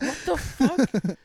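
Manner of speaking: high, loud, stretched-out disbelief; hammed-up incredulous yelp; mock shocked outcry. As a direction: cry out in exaggerated shock. Teller's shocked reaction in the story.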